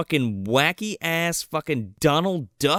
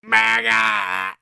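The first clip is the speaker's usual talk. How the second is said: higher, stretched-out squawk; mock bird call; 7 semitones higher than usual. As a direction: draw it out like an animal cry. Mimics human scream as birdcall.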